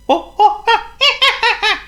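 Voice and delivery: shrill high chattering monkey laugh; high monkey giggle; squeaky monkey laugh. Acted. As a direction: cackle like an excited little monkey.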